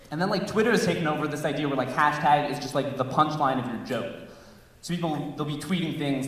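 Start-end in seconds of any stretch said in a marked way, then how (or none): none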